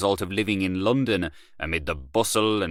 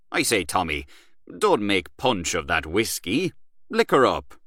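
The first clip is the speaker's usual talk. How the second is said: boastful Gallaher; 2 semitones higher than usual. Acted. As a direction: brag about Paris to an old friend. Gallaher dismissing London, pushing drink.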